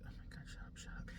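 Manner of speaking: hushed whisper under the breath; annoyed customer whispers to herself. Retold or imitated. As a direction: whisper it to yourself. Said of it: "I'm sitting there like".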